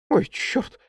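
sudden high yelp of alarm; startled cry. Acted. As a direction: yelp it like something bit you. (испуганный вскрик)

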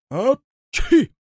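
big high sneeze; loud sneeze. Acted. Karabas sneezing at the fire. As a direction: let the sneeze explode.

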